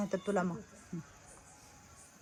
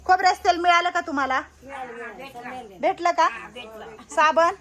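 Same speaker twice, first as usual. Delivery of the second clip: raised, higher voice so the elders can hear; loud asking; 5 semitones higher, 6 dB louder than usual. Real, not acted. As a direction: speak up clearly for grandmothers who hear poorly. Asking elders loudly about supplies.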